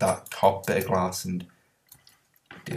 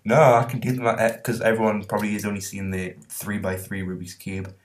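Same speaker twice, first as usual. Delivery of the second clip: usual speaking voice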